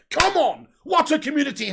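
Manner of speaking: high, loud burst of delight; excited cheer. Real, not acted. Streamer thrilled at hitting a hundred likes, cheering loudly. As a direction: cheer the viewers on.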